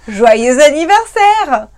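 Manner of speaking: loud, long drawn-out birthday wish; celebratory cheer. Real, not acted. Wishing the viewer happy birthday just in case, loud cheer. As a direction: draw it right out, celebrating.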